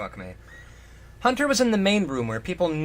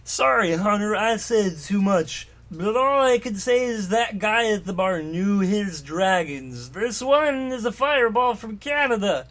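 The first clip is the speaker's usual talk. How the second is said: Shogrid voice; 5 semitones higher, 4 dB louder than usual. Acted. Shogrid telling Hunter about his pet, high and gushing.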